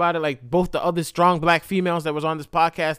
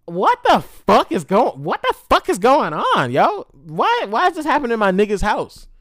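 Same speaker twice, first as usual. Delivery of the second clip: higher, louder bewildered exclamations; retold tearful upset; 5 semitones higher, 5 dB louder than usual. Storyteller upset at the friend's house, retold distraught disbelief. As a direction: replay distraught disbelief, without real crying. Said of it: "I start crying because I'm like".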